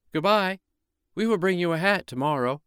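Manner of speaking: put-on high, loud children calling. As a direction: shout it back from the fence. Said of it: "they called to him".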